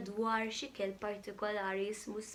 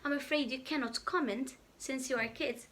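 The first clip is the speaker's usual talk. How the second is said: ordinary delivery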